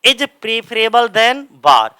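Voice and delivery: very slow, word by word; teacher spells the sentence out for students copying it; slow dictation. Shown as a demonstration. Slowly reads the example aloud for the class. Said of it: "जैसे हमने कहा".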